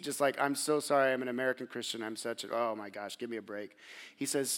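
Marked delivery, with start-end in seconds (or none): 0.4-3.8 mild mock sheepish apology, trailing off softer; a touch of sinking embarrassment, drifting down; lightly voiced, dropping softer and softer. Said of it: "sinking down in my seat".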